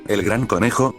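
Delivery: ordinary delivery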